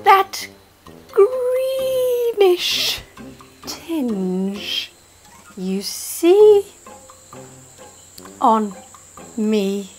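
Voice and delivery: slow sloth voice. Acted. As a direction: let each word drip out lazily like a sleepy sloth. Sloth speaks slowly to Lenny.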